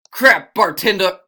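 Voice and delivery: loud, drawn-out angry call; enraged outburst. Acted. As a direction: yell at the bartender in acted rage. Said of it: "unholy rage came in a little bit".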